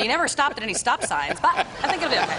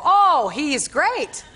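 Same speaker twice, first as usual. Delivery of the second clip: drawn-out, louder and higher gush; bluffed enthusiasm; 5 semitones higher, 6 dB louder, much slower than usual. Retold or imitated. Guest pretends to know Joey to her driver, gushing falsely. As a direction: overdo the praise to cover a bluff.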